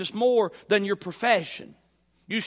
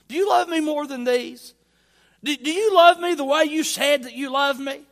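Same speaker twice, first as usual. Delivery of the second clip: raised, louder, insistent question; pressing challenge; 6 semitones higher, 6 dB louder than usual. Acted. Preacher voicing Jesus confronting Peter's claimed love, raised and pressing. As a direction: push the question at him, pitch lifted.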